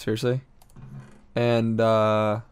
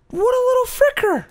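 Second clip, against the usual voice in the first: very high, loud outraged squeal; 5 dB louder than usual. Real, not acted. Gamer just shot by hidden player, outraged squeal. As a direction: shriek it at your attacker.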